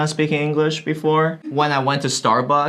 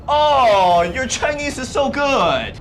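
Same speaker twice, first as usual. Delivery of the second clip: high, loud mock gush; exaggerated amazement at a beginner; gushing compliment; 8 semitones higher, 3 dB louder, slower than usual. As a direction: fawn over them with overdone admiration. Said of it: "why do they deserve any compliment".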